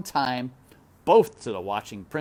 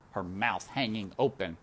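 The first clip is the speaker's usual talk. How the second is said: ordinary delivery, nothing marked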